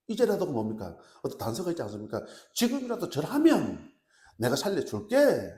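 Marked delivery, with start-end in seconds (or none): 2.5-5.5 loud, high opening, slowing on the promise; commanding ultimatum. Acted. Preacher acts out king offering life for bowing, commandingly. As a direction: offer mercy forcefully as a ruler.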